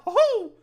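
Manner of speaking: high loud cartoon "ho-ho"; Mickey Mouse laugh. Shown as a demonstration. Streamer finishing the Mickey bit, high loud laugh. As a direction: squeak out a loud cartoon chuckle. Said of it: "sounding like Mickey Mouse".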